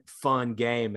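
ordinary talk, nothing marked

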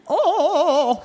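high-pitched wavering "oh" impression; Sarah Palin. Cohost showing off a political impression, high wavering voice. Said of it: "Sarah Palin".

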